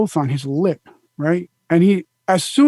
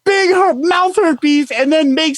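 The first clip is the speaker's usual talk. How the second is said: mock outraged yell; 7 semitones higher than usual. Retold outburst at the helper.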